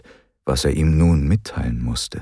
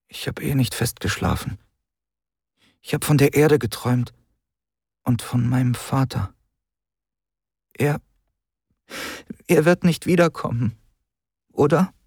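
put-on higher, fragile young voice; a boy asking what he fears to hear; higher, tentative child's question; boy's voice; 9 semitones higher than usual. A narrator's reading. Boy's voice in story.

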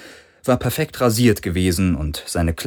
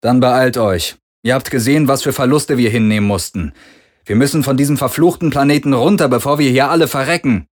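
louder, fast, pressing; urgent Parker; 5 semitones higher, 5 dB louder than usual. A narrator's reading. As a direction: hurry them, danger coming. Parker urges escape.

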